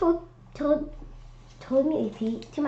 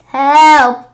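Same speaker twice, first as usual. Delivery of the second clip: loud, high cry for help; frightened call for help; shouting for help; 6 semitones higher, 16 dB louder than usual. Acted. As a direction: scream it as the footsteps close in. Footsteps closing in, calling out.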